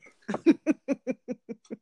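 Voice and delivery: a loud, high burst of laughter. Real, not acted. Host cracking up at her guest, loud high laugh.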